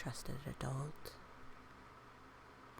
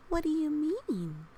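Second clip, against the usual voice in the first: puzzled question; 9 semitones higher, 5 dB louder than usual. Acted.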